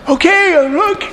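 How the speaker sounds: put-on high, comic old man squeak; Abraham voice. Abraham answers God in a high comic squeak.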